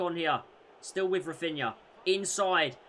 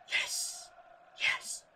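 whispered yes; 7 dB quieter than usual. Real, not acted. Quiet celebration after goal.

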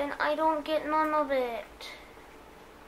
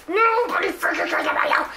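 higher, louder yelled taunt; 6 semitones higher, 7 dB louder than usual. Real, not acted. Gamer yelling a taunt at an enemy.

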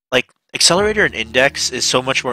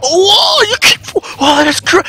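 much higher, breathless amazement; words falling apart from hype; excited gasping; 4 dB louder than usual. Retold or imitated. Reacting to buoyancy power.

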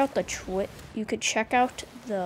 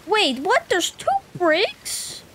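startled; 9 semitones higher, 5 dB louder than usual. Real, not acted. Sudden surprise at two brigs.

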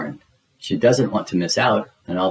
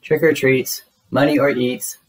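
cheerful call; 5 semitones higher than usual. A narrator's reading. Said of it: "they yell at the first door".

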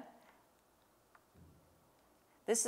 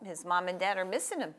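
ordinary talk, nothing marked

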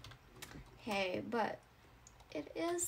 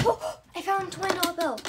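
loud fast delight; excited; 5 semitones higher, 8 dB louder, much faster than usual. Acted. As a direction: burst out with joy. Finds money.

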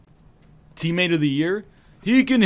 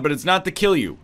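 ordinary talk, nothing marked

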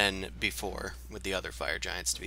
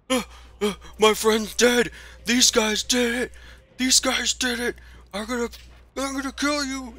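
high, loud, frantic ogre voice; angry ogre yelling; 6 dB louder than usual. Acted. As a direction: shriek it as a furious monster. Ogre shouts at party over dead friend.

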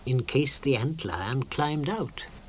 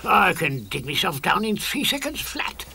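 strange voiced, higher boast; boastful ant lion; 6 semitones higher than usual. The boastful ant lion brags to Moomin Troll, higher and strange. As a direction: brag about your speed.

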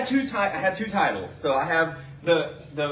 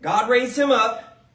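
scripture reading; 4 semitones higher than usual. Acted. Reads scripture aloud in sermon.

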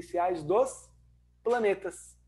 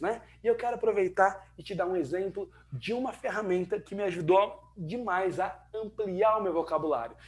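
ordinary delivery

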